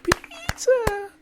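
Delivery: very high, loud whooping cheer with laughter; cheering crowd. Acted.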